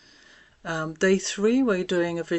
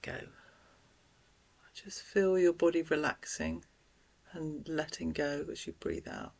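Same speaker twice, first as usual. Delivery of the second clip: guided breathing; 4 dB quieter than usual. Acted. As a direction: gently talk them through each breath, leaving room to breathe. Leads deep breathing exercise.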